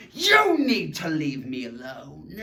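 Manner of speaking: slow, emphatic demand with an exclamation. Shown as a demonstration. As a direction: say it as a dramatic order. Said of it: "to have more emotion".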